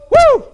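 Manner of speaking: high, loud joyful "Woo"; excited whoop. Real, not acted. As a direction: let out a happy whoop. Excited preacher shout.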